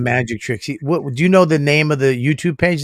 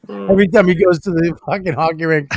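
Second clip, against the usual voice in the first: usual speaking voice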